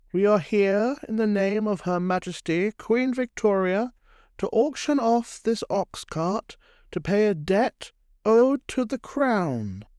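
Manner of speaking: high, formal proclamation, drawing out the last words; sheriff opening an auction. Acted. Sheriff to a sullen crowd as he starts the auction, proclaiming. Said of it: "as he starts the auction".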